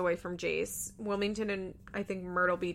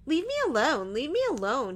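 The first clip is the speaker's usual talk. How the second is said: higher, whiny baby-voiced mimicry; mocking whine; 5 semitones higher than usual. [name] mocking Janelle, higher and whining. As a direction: mimic her like a whining little kid. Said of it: "[name] starts to mock her".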